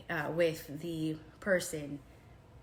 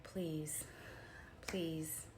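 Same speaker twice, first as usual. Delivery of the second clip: whispered begging, barely voiced; pleading whisper; 9 dB quieter than usual. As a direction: beg softly under your breath. Begging him for the lead.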